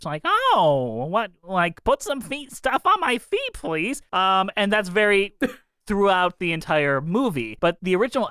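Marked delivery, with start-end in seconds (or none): 0.2-4.0 nasal high nanny whine; whiny Queens nanny, lightly done; Fran the nanny voice. Retold or imitated. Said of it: "like, Fran the nanny voice".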